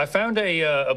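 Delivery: ordinary talk, nothing marked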